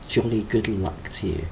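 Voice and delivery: a lightly put-on British accent. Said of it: "she says in her British voice".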